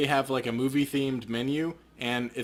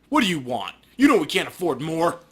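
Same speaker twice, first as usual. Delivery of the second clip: louder, hostile demand; hard and angry; 4 semitones higher, 7 dB louder than usual. Acted. Shopkeeper Manny turns on Paco, hard and angry. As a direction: snap at him to state his business. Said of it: "eyes become hard and angry".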